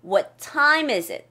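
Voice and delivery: "time" said slowly and clearly stressed. Shown as a demonstration. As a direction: lean on "time" in What time is it. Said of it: "time의 소리를 올리세요".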